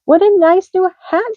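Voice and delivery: high delighted exclaim; thrilled at gift; delighted cry. A narrator's reading.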